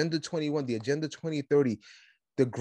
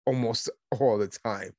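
usual speaking voice

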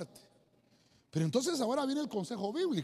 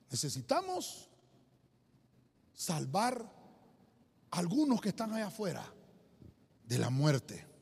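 normal talking voice

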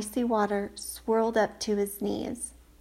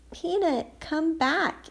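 higher calling voice; 7 semitones higher, slower than usual. Acted. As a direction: call after the runaway mouse. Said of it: "Annie called".

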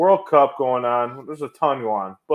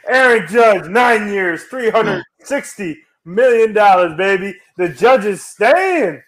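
raised pitch, stretched-out celebration; excited announcement; 6 semitones higher, slower than usual. Real, not acted. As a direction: proclaim the big news slowly and triumphantly. Celebrating star player's re-signing.

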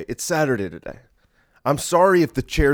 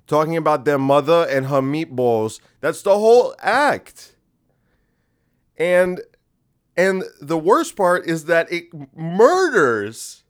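louder, higher, slow exasperated rant; 5 semitones higher, 6 dB louder, slower than usual. Real, not acted. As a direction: rant like you cannot believe it.

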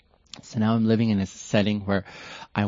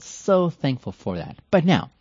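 normal talking voice

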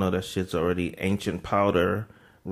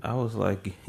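usual speaking voice

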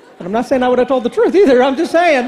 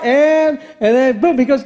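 normal talking voice

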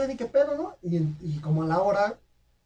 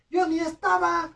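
mock whiny denial; 12 semitones higher, 4 dB louder, slower than usual. Retold own denial.

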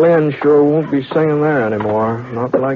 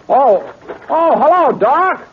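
raised, high call over a distance; calling back; 13 semitones higher, 3 dB louder, slower than usual. Acted. Britt answers the doctor's call from afar, calling back. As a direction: call out so he hears across the street.

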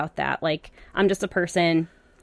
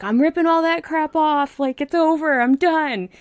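high, exasperated quote of last night's outburst; angry rant, retold; 10 semitones higher than usual. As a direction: re-enact being fed up, pitched well up. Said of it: "I was so angry".

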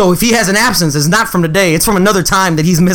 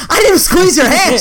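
laughing protest; 9 semitones higher than usual. Retold or imitated.

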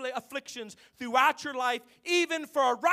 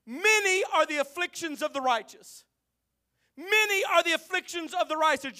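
raised, ringing scripture reading; preaching; 6 semitones higher, 6 dB louder than usual. Acted. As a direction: proclaim the verse to the congregation.

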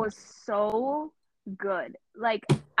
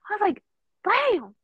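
high, stunned "Damn"; shocked; 10 semitones higher, 4 dB louder than usual. Retold or imitated. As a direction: react to a shocking bill. Shocked at the bill.

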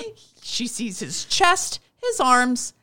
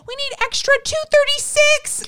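very high, loud excited squeal; giddy fangirl; 9 dB louder than usual. Real, not acted. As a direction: squeal it like a starstruck fan. Fantasizing being an extra.

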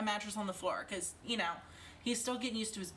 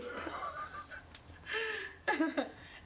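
high, breathless peal of laughter; 12 semitones higher than usual. Real, not acted. Mother laughing about her toddler son's goodbye, high helpless laughter.

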